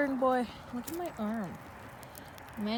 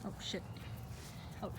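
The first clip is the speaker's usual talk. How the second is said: normal talking voice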